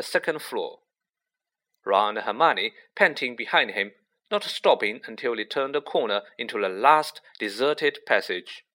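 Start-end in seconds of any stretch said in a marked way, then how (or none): none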